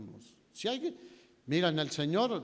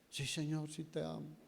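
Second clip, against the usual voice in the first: soft, understated acted reply; hushed avowal of love; subdued answer; 8 dB quieter than usual. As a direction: answer quietly, voice held back. Peter answers the risen Lord.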